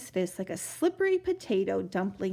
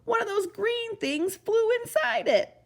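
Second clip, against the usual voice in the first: dismayed trumpeter; 12 semitones higher than usual. Acted.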